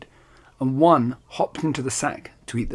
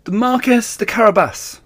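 high, loud chorus reply; harvesters calling back; 9 semitones higher, 5 dB louder than usual. Acted. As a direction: shout the answer together. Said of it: "they replied".

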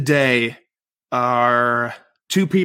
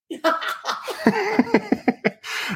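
laughing; 3 dB quieter than usual. Real, not acted. Laughs at own age joke.

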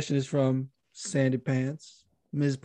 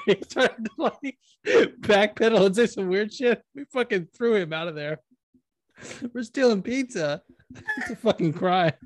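laughing; 7 semitones higher than usual. Real, not acted. Laughing through story.

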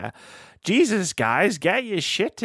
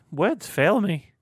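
stunned, slow; 6 semitones higher, much slower than usual. Real, not acted.

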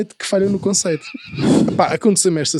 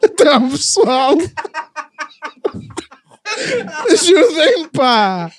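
laughing plea; 9 semitones higher, much slower than usual. Real, not acted. Pleading while others laugh.

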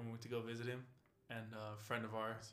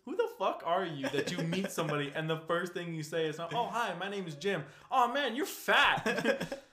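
angry rant; 6 semitones higher, 10 dB louder than usual. Retold or imitated. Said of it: "I tore into him".

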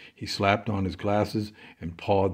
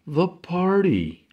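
awed; 10 semitones higher, 5 dB louder, slower than usual. Acted. Awed arrival at party.

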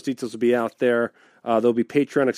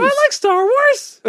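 very high, slow squeak; silly high voice; 8 dB louder, slower than usual. Acted.

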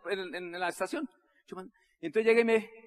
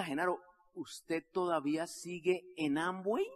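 slow, soft, deliberate asking; careful question; 3 semitones lower, 5 dB quieter, slower than usual. Retold or imitated. César asks Genaro the big question, careful. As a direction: space it out gently for suspense.

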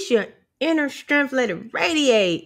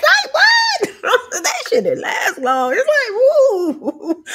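high shrieking laugh through the words; 13 semitones higher, 4 dB louder, slower than usual. Real, not acted. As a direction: burst out laughing mid-line.

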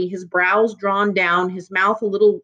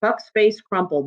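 plain reading voice

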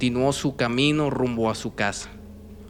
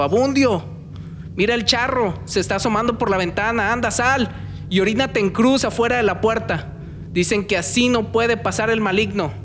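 panicked shout; 10 semitones higher than usual. Acted. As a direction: scream the warning in terror. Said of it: "le gritó".

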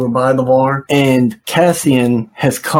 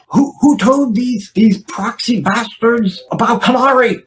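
agitated mother; 9 semitones higher than usual. Retold or imitated. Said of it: "she becomes really agitated".

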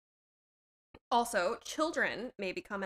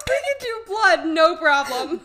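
laughing; 6 semitones higher, 12 dB louder than usual. Real, not acted. Laughing at Jenny gagging.

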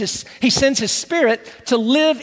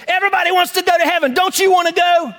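high, fast, fired-up preacher calling out; 7 semitones higher than usual. Retold or imitated. Preacher calling out to a boy in the front row, fired up. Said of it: "and he goes".

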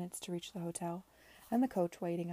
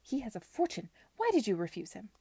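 loud, higher furious scolding; angry; 3 semitones higher, 6 dB louder than usual. Acted. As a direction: demand an answer, outraged. Furious aunt scolding niece.

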